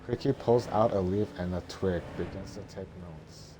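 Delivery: plain reading voice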